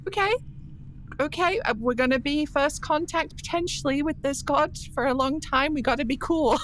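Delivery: high, breathless pep talk to herself; calm yourself down before a god; flustered scholar psyching herself up; nervous self-talk. Acted. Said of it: "she's like".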